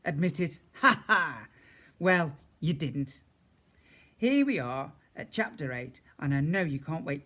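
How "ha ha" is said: loud, cheerful laugh; hearty laugh. Real, not acted. Reader laughs.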